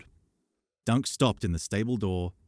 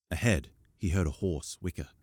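plain reading voice

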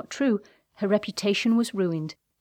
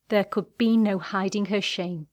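plain reading voice

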